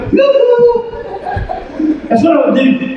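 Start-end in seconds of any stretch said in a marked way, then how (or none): 0.1-0.8 very high singsong hail; yoo-hoo call. Shown as a demonstration. Preacher modernizing behold for the congregation, high yoo-hoo call. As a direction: trill it out for attention, far above speaking pitch. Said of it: "I go".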